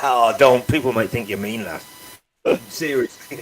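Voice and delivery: loud, amused warning; laughing protest. Real, not acted. Telling the cohost not to joke, laughing and loud. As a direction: warn him off through laughter.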